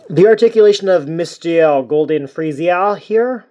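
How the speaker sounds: hammed-up bad French accent; terrible French accent. Presenting the figure's articulation, horrible French accent. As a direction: jump into an awful mock French accent. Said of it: "terrible French accent".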